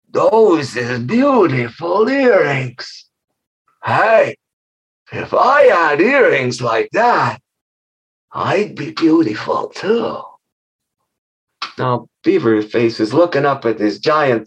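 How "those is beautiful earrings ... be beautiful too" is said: covet the earrings, slow and greedy; vain monster. Acted. Monster admiring the shimmering earrings, slow greedy. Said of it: "she goes".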